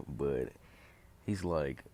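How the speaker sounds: usual speaking voice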